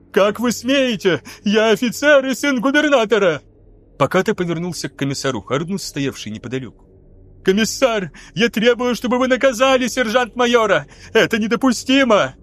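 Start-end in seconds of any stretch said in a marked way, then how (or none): 0.1-3.4 a pampered officer sputtering in outrage; indignant lieutenant. A narrator's reading. Struck lieutenant protests to the sergeant-major, indignant. 7.4-12.3 higher, whining demand; a spoiled officer insisting on his rank; demanding lieutenant. A narrator's reading. Lieutenant appeals to the commissar for punishment, whining.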